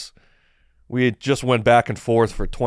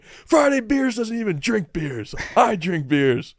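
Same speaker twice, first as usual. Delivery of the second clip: much higher, slow, hammed-up taunt; mocking the meme page in a high, slow voice; mock cocky video persona; 10 semitones higher, slower than usual. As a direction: play a smug guy mocking a rival page, pitched way up. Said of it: "I'm like".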